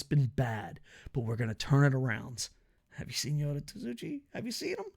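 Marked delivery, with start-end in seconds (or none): 2.9-4.8 squeaky high, hushed teasing question; pitched far up, soft and giddy; mock excited. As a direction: ask it in a tiny high voice like a fan. Hyping wrestler to audience.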